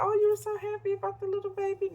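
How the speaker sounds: high, quick gush of assumed happiness; gushing retold question. Replying to friend about the baby, gushing retold question.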